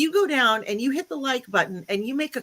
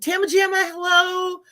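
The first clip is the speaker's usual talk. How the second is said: excited shout-out; 7 semitones higher, 4 dB louder than usual. Real, not acted. Calling out greetings to chat.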